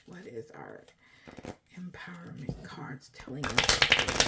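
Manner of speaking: whispered repeat of the question; hushed whispered ask. Shown as a demonstration. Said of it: "repeated in whisper".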